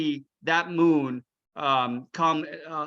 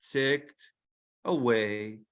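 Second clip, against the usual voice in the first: plain reading voice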